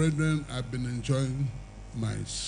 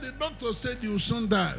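wife's voice; 8 semitones higher, 3 dB quieter than usual. Retold or imitated. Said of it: "She said".

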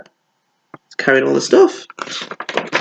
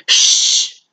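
whispered shh. A narrator's reading. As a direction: hush them like a secret. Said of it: "they all whispered".

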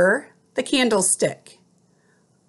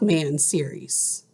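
whisper; 6 semitones lower, 6 dB quieter than usual. Real, not acted. Whisper mid sentence in dictation.

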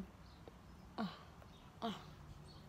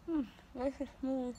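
ordinary talk

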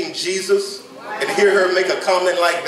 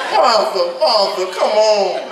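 exasperated Jesus; 3 semitones higher, 3 dB louder than usual. Retold or imitated. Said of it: "scrunched up face on Jesus".

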